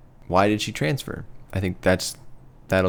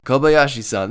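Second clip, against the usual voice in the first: put-on Japanese accent; 7 semitones higher, 6 dB louder than usual. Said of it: "you must do it in a Japanese accent".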